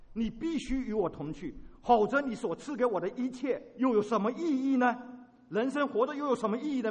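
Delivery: loud, pleading with rising urgency; impassioned plea. Acted. 摩西向神恳求同去，大声迫切地恳求. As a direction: beg God not to leave you.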